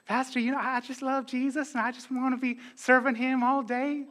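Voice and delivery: high, put-on Southern churchgoer voice; Southern accent. Masked churchgoer gushing to the pastor, mock Southern accent. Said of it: "I got two accents, Irish and Southern".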